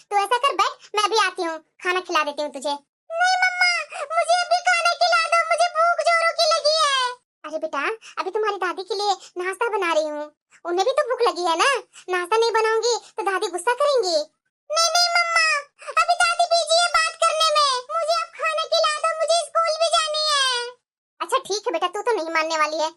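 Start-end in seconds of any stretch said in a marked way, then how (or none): 3.1-7.1 put-on high, insistent child voice; pleading little child. Hungry child asks mother to feed her, pleading. 14.2-20.5 put-on high, insistent child voice; pleading little child. Hungry child asks mother to feed her, pleading.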